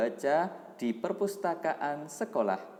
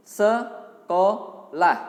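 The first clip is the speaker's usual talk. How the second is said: very slow, loud, syllable-stretched; sounding out words; 6 semitones higher, 8 dB louder, much slower than usual. Shown as a demonstration. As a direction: stretch each syllable loudly for the class. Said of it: "Sekarang berikutnya". (mengeja pelan per suku kata)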